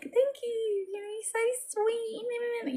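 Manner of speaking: high, soft sugary sweetness; sugary thank you. Retold or imitated. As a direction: coo it back like to a small child. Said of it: "I'm just like".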